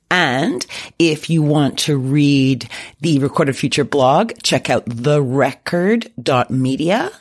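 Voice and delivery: slow, measured host read; podcast plug read. Acted. Host to listeners, plugging guest's links, slow measured read. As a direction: spell out the plugs slowly and deliberately. Host reads the sign-off plugs.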